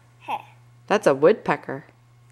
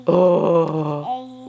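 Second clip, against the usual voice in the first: drawn-out gorilla "ooh"; gorilla hoot. Pretend. Parent answers what gorilla says, gorilla hooting. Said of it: "What does a gorilla say?".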